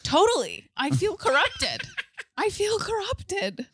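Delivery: high-pitched hammed-up confession; mock dramatic. Mock reply to the British lady.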